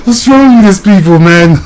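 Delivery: words squeezed out through high, loud laughter; can barely get it out for laughing. Real, not acted. Ranting vlogger asks his viewers through tears of laughter. Cracking up mid-rant.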